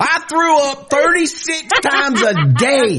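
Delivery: high, exaggerated put-upon mother; hammed-up mom lording it over her kid; guilt-tripping mom.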